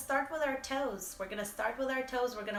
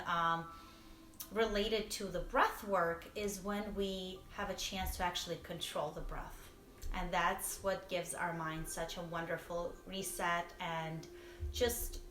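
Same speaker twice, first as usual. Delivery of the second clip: ordinary talk, nothing marked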